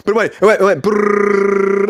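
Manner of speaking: slow, stretched, garbled audio sound; imitated glitch noise. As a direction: mimic the audio breaking up. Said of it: "that's what I did".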